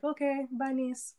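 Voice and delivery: the aunt cutting the hug short; aunt brushing off. Retold or imitated. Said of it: "she was just like".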